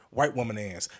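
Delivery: normal talking voice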